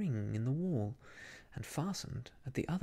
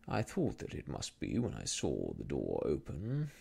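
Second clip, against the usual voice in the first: low, smiling menace of Mister Rucastle; smiling villain; 11 semitones lower than usual. A narrator's reading. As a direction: purr it low with a false smile.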